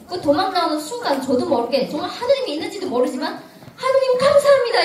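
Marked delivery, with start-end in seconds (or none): 3.8-4.8 much higher, much louder burst of thanks; overjoyed cry of thanks. Retold or imitated. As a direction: blurt it out in disbelieving joy. Bursting out thanks while fleeing.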